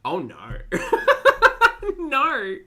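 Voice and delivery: ordinary delivery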